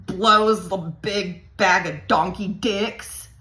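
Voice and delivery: very slow, every word stretched; deliberate, word-by-word griping; drawn-out complaint. Retold or imitated. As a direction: drag out the punchline for comic weight. Drawn-out punchline of acted complaint.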